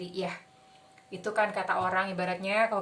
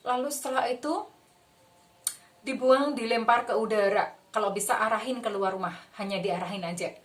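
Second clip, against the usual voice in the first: usual speaking voice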